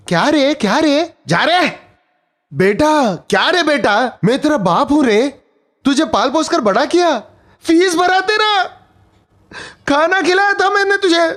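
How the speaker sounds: a hurt dad berating the son who rejected him; father scolding his ungrateful son, growing heated; angry father. Acted.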